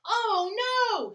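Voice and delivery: high, loud alarmed scream. Acted. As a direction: shriek in dismay. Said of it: "screamed Mole and Bear".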